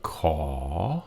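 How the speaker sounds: ordinary talk, nothing marked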